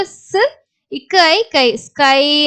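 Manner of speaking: "ஸ்", "இக்கு ஐ" said in pieces, then joined as "ஸ்கை"; teacher building "ஸ்கை" sound by sound; sounded-out blending. Shown as a demonstration. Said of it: "அப்படின்னு படிக்கணும்". ("ஸ்", "கை" பிரித்து "ஸ்கை" என சேர்த்து)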